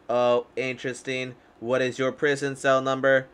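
ordinary delivery

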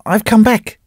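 put-on broken old man. A narrator's reading. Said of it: "he repeated".

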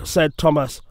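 usual speaking voice

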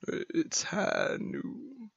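very slow, soft gunslinger line; slow cowboy line. Acted. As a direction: draw it out like a showdown. McCree catchphrase, slow.

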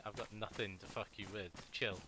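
ordinary delivery

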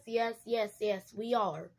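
usual speaking voice

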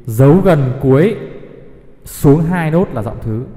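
slow, deliberate, leaning on gần and nốt; slow emphatic rule. Shown as a demonstration. Music teacher drilling the minor-key rule for students, slow and emphatic. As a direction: spell the rule out slowly so it sinks in. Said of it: "teacher states the rule for students".

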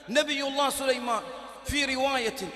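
loud preaching burst; صيحة خطابية. Real, not acted. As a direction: call it out to the crowd. خطيب يرفع صوته بحماس. (صيحة وعظ عالية)

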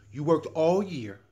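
usual speaking voice

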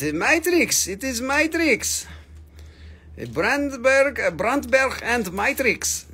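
high, drawn-out attempt at a Boer accent; hammed-up Afrikaner accent. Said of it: "my Boer accent going on".

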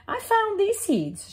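high, bright hen voice; little red hen. Acted. The hen asking neighbours about seeds, in a high voice.

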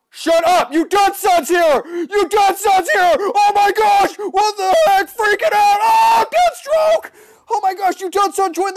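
high, loud shouting, slowing to a drawn-out cry; frantic excited shouting. Real, not acted. Gamer freaks out as famous player joins, frantic shouting. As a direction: lose it completely, yelling.

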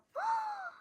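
high, sharp gasp; high-pitched intake of breath; delighted gasp. Real, not acted. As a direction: gasp at something adorable. Sees cute photo.

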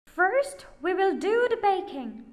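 high, loud, brisk witch voice; bossy witch. A narrator's reading. Witch planning the oven, high and loud. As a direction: rattle off orders like a scheming witch.

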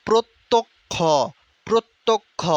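প্রত্যক্ষ in three beats, "prot... tok... kho"; syllable drill. Shown as a demonstration. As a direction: pause after each syllable of প্রত্যক্ষ. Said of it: "বারবার practice করতে হবে". (প্রত্যক্ষ তিন ভাগে "প্রোৎ... তোক্... খো")

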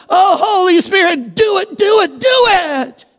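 high, pushy mock plea; mock demanding prayer. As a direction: ham up a whiny prayer ordering God around. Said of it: "You see, it's not".

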